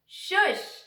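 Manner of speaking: loud high shush thrown back; high loud mocking shush. A narrator's reading. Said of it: "Fudge said back to them".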